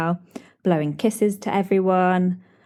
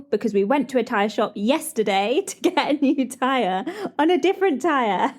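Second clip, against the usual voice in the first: giggling through the words, a bit higher; laughing; 6 semitones higher than usual. Real, not acted. Telling listeners about the triple price, laughing. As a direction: laugh it out as you explain. Laughing at own tyre mishap.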